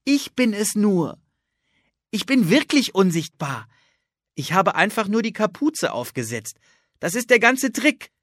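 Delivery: proud of her trick; girl Anne, delighted. Acted. Anne's voice held.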